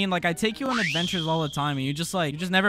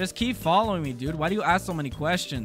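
normal talking voice

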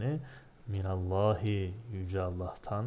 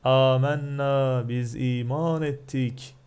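loud, drawn-out proclamation, pitched up; imitated cry out; 4 semitones higher, 7 dB louder, slower than usual. Teacher voicing the sorcerers crying out their faith, loud imitated cry. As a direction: call it out slowly, imitating a crowd's cry. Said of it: "Çığlık atarak şöyle dediler".

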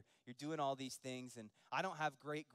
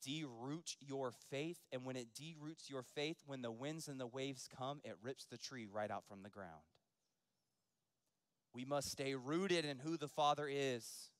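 usual speaking voice

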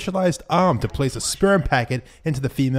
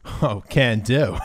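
ordinary delivery

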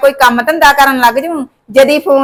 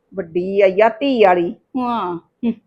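sarcastic mutter; 6 semitones lower, 7 dB quieter than usual. Acted. Mother-in-law scoffs after the quarrel.

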